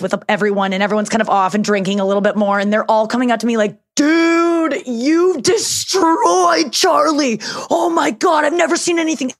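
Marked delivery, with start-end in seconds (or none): 3.9-9.3 excited crew guy. Retold or imitated. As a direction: imitate a hyped-up buddy at a party. Crew members gush about her roast.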